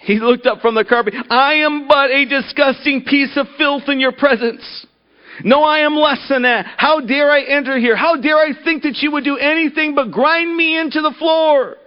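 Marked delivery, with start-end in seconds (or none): 1.3-11.8 high, pleading self-abasement; groveling. Acted. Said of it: "the man went on groveling".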